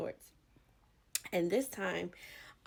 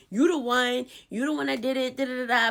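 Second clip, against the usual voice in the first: loud, higher accusation; accusing; 7 semitones higher, 11 dB louder than usual. Retold or imitated. Calling out the sinner, loud and high. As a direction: point the finger and call them out. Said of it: "you outright be like".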